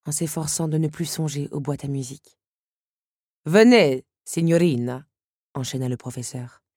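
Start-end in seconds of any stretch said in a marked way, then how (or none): 3.4-5.1 lightly voiced Italian accent, louder Venez; an Italian professor's courteous invitation in a light Italian accent; charming Italian accent. A narrator's reading. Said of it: "avec un charmant accent".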